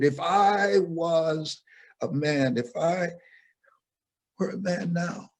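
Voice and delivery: put-on pleading boy. Boy pleading with his mother about becoming a man. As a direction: beg to grow up now.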